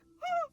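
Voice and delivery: high, loud exaggerated "oh"; hammed-up shocked cry; mock horrified gasp. Wife watching dad die, mock shocked cry. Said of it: "Anne Hathaway is like".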